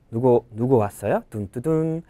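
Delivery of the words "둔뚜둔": usual speaking voice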